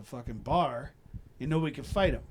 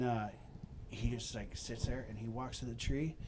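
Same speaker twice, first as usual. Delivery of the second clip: ordinary delivery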